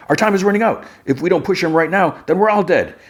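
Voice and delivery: high, urgent mock yell; retold yell. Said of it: "One hundred yells, he's like".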